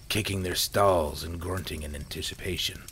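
ordinary delivery, nothing marked

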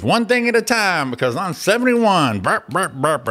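mock her fussing like a tired old woman; a hammed-up old lady with burps. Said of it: "She goes".

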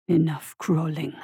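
put-on low, slow, heavily accented English; low slow voice with a heavy foreign accent; heavily accented creature. A narrator's reading. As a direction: press each word slowly with a thick foreign accent. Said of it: "so heavily accented".

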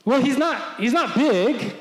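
high, loud ring announcer call; announcer introducing a fighter to the crowd; Bruce Buffer. Retold or imitated. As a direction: boom it out to a packed arena. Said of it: "Bruce Buffer is getting the crowd pumped up".

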